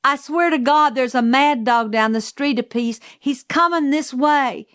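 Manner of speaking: high-pitched, pressing report; urgent alarm. A narrator's reading. Calpurnia warning Atticus about a mad dog, alarmed.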